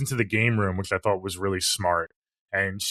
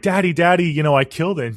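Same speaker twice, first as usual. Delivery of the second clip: ditzy daughter; 8 semitones higher, 5 dB louder, faster than usual. Retold or imitated. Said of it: "she's like".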